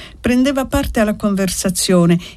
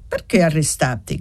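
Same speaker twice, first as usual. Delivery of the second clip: ordinary delivery, nothing marked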